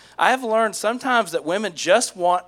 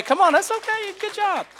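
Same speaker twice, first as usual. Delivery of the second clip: sing-song praise; 11 semitones higher, 4 dB quieter than usual. Pretend. Mock soothing a child.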